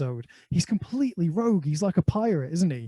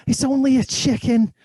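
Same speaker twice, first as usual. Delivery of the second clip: higher, louder Scouse line; Scouse accent; 8 semitones higher, 6 dB louder than usual. Retold or imitated. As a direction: whine it like a Liverpool lad. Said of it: "he's a Scouser".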